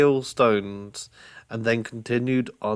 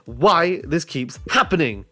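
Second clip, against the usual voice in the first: higher, emphatic fed-up complaint; exasperated rant; 4 semitones higher, 3 dB louder than usual. Acted. Brother fuming at being threatened with eating, exasperated rant. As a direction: punch the words in exasperation. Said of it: "Steam was particularly coming from his ears".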